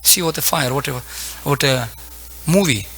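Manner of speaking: usual speaking voice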